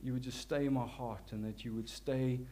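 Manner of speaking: ordinary delivery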